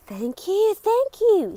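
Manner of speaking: high, loud grateful gushing; excited thanks. Acted. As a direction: gush with delighted gratitude. Mister Good overjoyed.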